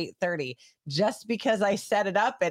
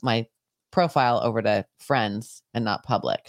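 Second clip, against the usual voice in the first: normal talking voice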